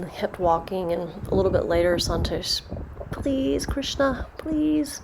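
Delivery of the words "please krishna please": higher-pitched, imploring plea; pleading. Acted. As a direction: beg like a devoted follower. Santosh begs Krishna in story.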